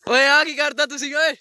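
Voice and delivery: high loud call; loud high Punjabi shout at them. Real, not acted. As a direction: holler across to them. Calls out in Punjabi.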